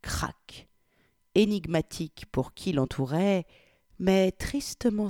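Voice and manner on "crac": a soft breathy whisper of defeat; whispered lament. A narrator's reading. The humiliated lover at supper, whispering crac. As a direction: let the one word fall out, voiceless. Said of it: "d'un ton lamentable".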